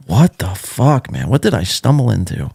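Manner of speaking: ordinary talk, nothing marked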